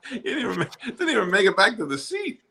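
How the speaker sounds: high, laughing through the words; cracking up mid-sentence. Real, not acted. Laughing at a spill.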